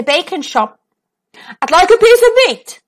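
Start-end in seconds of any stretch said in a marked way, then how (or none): 1.6-2.8 loud, higher booming voice. Acted. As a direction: boom it across the shop. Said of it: "Mister Noisy boomed".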